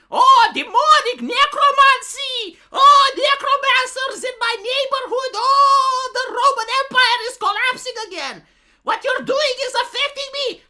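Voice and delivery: high, loud panicked shrieking; overacted superstitious villager wailing; hysterical medieval peasant. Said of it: "are we going to pretend we're back in the medieval ages and this is like".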